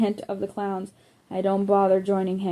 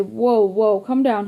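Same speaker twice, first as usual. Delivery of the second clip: startled, raised calming voice; alarmed; 6 dB louder than usual. Acted. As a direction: hold him back, alarmed. Friend calming panicked narrator.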